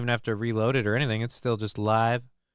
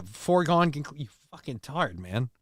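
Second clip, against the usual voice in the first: ordinary delivery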